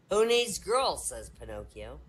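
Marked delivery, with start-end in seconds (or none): 0.1-1.0 loud, high punchline. Acted. Pinocchio punchline.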